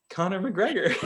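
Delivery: high, squeezed through laughter; giggly retold answer; laughing imitation. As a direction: mimic a clueless friend while cracking up. Mimics friend, cracking up laughing.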